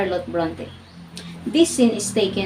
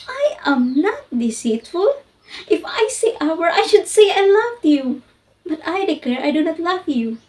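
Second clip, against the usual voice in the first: forceful, deliberate declarations of dislike; blunt defiance; 7 semitones higher, 5 dB louder than usual. Acted. Jane Eyre confronts her aunt Missus Reed, blunt and defiant. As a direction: stand up to her and punch the key words. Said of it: "launched them in this blunt sentence".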